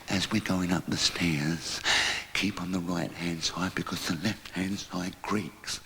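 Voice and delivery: slow, soft warning on the stairs; creeping upstairs past the landlady; hushed and slow. Acted. Sneaking up creaky stairs.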